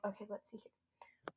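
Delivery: quiet muttered whisper to herself; hushed, under her breath. Real, not acted. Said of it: "whispering to self".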